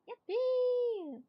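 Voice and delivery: high squealed yippee; excited cheer. Real, not acted. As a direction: burst out with a gleeful whoop. Cheerful break from the story.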